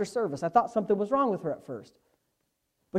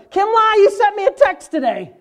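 higher, louder, slowing to the punchline; preaching at full force; 13 semitones higher, 13 dB louder than usual. Acted. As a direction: drive the point home from the pulpit.

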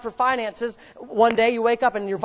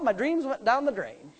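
normal talking voice

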